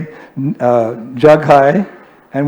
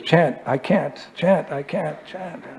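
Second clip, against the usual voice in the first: softer, gently coaxing repeat; 3 semitones higher, 7 dB quieter, slower than usual. Retold or imitated. Prabhupada gently urging a reluctant guest, softly. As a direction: coax them quietly to chant. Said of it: "Like Prabhupada would say sometimes".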